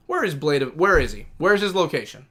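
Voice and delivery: ordinary talk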